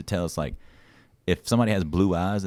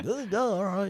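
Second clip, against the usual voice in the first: much higher goofy drawl; dopey drawl; 11 semitones higher, 6 dB quieter, slower than usual. Retold or imitated. Mock Texan answering Trevor with a dopey drawl. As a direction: play it dim and slow. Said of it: "Roy's like".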